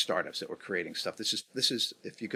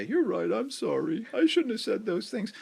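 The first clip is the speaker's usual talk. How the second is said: high, hammed-up sheepish apology; mock apology; 12 semitones higher than usual. Imitating the client backing down, hat in hand, mock sheepish. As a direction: play the humbled client eating his words.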